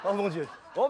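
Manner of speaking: ordinary talk, nothing marked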